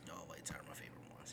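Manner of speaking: fast breathy aside; whispering. Real, not acted. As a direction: toss it off under your breath. Repeats preference softly.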